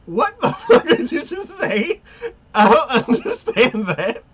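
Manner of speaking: high, loud, laughing outburst; baffled, breaking up mid-sentence; laughing disbelief. Real, not acted. Friend reacting to the chef's nonsense, laughing loudly. Reacting to absurd chef line.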